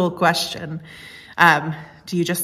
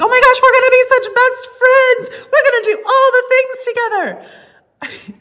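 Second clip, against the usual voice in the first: much higher, much louder squealing gush with a laugh; a laughing, squealing overeager new friend; 9 dB louder than usual. Retold or imitated. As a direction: come on way too strong, squealing. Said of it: "you came on a little too strong".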